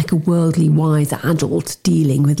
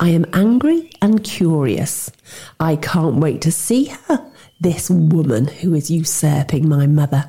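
plain reading voice

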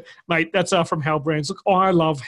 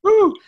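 very high loud whoop; excited whoop; 9 dB louder than usual. Real, not acted.

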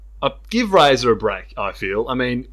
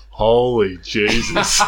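laughing exclamation; 3 dB louder, much slower than usual. Retold or imitated.

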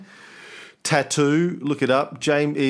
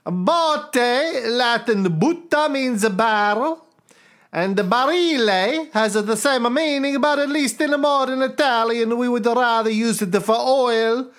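bad Italian accent; 10 semitones higher than usual. Reading a listener's letter to the cohost in a mock Italian accent. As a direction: read the listener's email in a comic Italian accent. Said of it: "trying to think of what my Italian accent".

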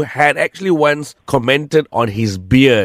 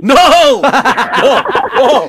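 laughing no; 12 semitones higher, 5 dB louder, much slower than usual. Real, not acted. Host laughingly rejects coffee date.

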